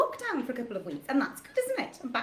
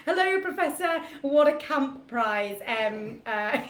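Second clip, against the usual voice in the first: ordinary talk, nothing marked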